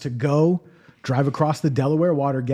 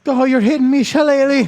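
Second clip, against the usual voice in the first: loud, high hammed-up Irish woman; Irish accent; 11 semitones higher, 9 dB louder than usual. Irish woman in bed, exaggerated Irish accent. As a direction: squeal it in a mock Irish accent. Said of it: "this Irish chick from Ireland".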